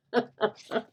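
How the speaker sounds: short amused laugh; real little burst of laughter. Real, not acted. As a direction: chuckle at your own joke. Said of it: "I don't know why I'm laughing".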